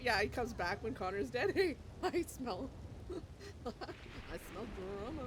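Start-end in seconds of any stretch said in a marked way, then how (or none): 1.5-5.3 slow, drawn-out, laughing sing-song; savoring the gossip to come; playful tease. Real, not acted. Teasing viewers about drama ahead, slow laughing sing-song.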